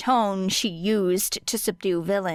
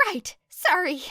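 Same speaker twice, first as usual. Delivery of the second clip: higher, quick sheepish apology; Hizashi voice; 10 semitones higher than usual. Acted. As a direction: back down fast. Hizashi chastened.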